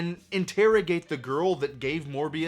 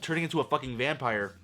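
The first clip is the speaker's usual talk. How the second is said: ordinary talk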